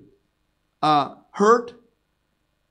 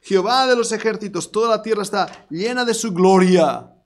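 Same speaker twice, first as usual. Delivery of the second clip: seraphim crying out; 3 semitones higher, 4 dB louder than usual. Acted. Seraphim calling to one another loudly. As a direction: cry out holy like angels.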